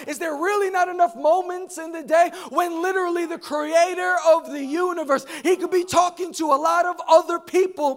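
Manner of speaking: loud, high, driving sermon delivery; impassioned preaching. Real, not acted. Preacher urges church to hear God directly, fervent and loud. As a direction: build to a full-force exhortation.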